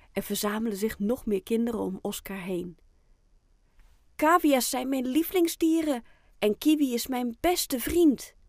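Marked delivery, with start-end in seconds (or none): 4.1-8.3 put-on higher, louder, boastful child voice; proud. A narrator's reading. Boy showing off his best friend, proud and high. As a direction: declare it proudly, pitched up and emphatic. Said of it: "zegt Oscar trots".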